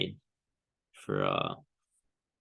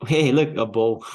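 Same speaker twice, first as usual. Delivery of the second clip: laughing; 3 semitones higher, 6 dB louder than usual. Real, not acted. Spots a bow shape on chart.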